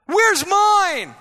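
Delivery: high, loud mocking whine; mock whining demand. Preacher mocking a whining believer, childish whine. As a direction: mimic a spoiled child. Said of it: "cry like a baby".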